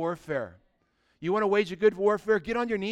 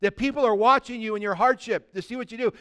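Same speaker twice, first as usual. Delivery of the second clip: ordinary talk, nothing marked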